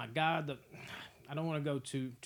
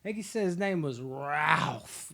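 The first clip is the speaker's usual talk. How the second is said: slow stoner drawl; 6 dB louder, slower than usual. Retold or imitated. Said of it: "He's like".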